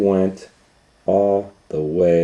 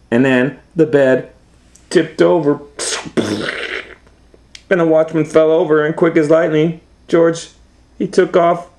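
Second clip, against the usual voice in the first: higher, building excitement as the chase goes; excited storytelling; 10 semitones higher, 4 dB louder than usual. A narrator's reading. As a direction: tell the escape breathlessly, pitched way up. Excited suspense in the escape.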